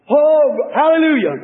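a high, ringing hallelujah burst out in joy; real excitement breaking through; can't hold back the praise; excited shout. Real, not acted. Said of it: "I'm not excited".

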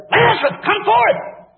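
loud, high ringing command; commanding call. Retold or imitated. Jesus calls dead Lazarus from the tomb, loud high command.

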